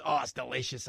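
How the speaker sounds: ordinary talk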